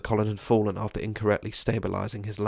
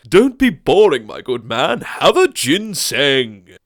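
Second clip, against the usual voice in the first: louder, drawn-out coaxing; pushy gent; 5 semitones higher, 9 dB louder, slower than usual. Acted. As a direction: coax him, stretching the last line slow. Crowd offering teas.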